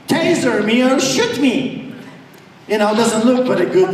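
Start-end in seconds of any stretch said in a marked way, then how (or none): 0.1-1.9 higher defiant dare; daring the officer; a touch of mock bravado. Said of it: "what I said to him".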